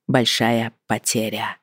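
slow, soft, hushed большая потеря. A narrator's reading. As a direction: trail off quietly on большая потеря. Narration ends on mysterious words.

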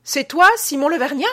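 high, loud call into the dark; second voice shout. A narrator's reading. Man calls out to Simon Levergnat from the dark, high and loud. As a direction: shout the name from the cellars. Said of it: "Il criait".

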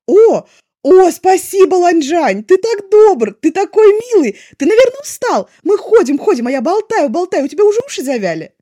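very loud, high, overjoyed; gushing thanks. A narrator's reading. Wei Ying thanks Lanzhan, loud and overjoyed. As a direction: burst with delight at the compliment.

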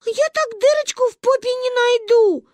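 put-on high, wailing little-girl voice; sobbing child. A narrator's reading. Granddaughter confused by the cup, sobbing harder. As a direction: bawl it in helpless high whine. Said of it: "пуще прежнего зарыдала Гайне".

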